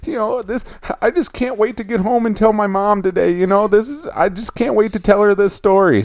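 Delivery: put-on high little-girl voice, choked with joy; imitated girl fighting tears. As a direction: act out a happy child holding back tears. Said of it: "fighting back the tears and stuff".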